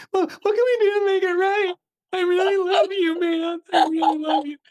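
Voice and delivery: high, pleading mock sob; dumped boyfriend. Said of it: "like that dumped boyfriend".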